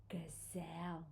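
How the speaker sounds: soft, low whisper of wonder; very quiet, secretive announcement; hushed reveal. Acted. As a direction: whisper the animal's name. Hushed reveal of last animal.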